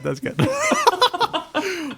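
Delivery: laughing. Real, not acted. Laughing repeat.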